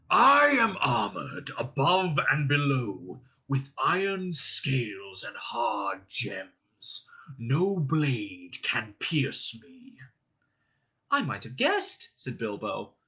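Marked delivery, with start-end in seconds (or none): 0.1-10.0 slow, booming dragon boast; gloating dragon. Acted. 11.1-12.1 higher, earnest voice putting on courage; a small hobbit puffing himself up before a dragon; high, forced boldness; brave hobbit. Acted. Bilbo flatters.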